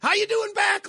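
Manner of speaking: high, chummy echo; mock friendly reply. Stranger returning greeting, high and chummy. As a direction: answer like an old friend. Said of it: "like that, like he recognized me".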